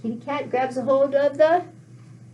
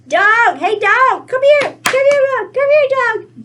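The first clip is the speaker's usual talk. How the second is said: high, loud, excited calling; calling the dog; 10 semitones higher, 8 dB louder than usual. Acted. Little girl calling her dog over to help, high loud call. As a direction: shout out for the dog, pitched way up. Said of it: "So the little girl says".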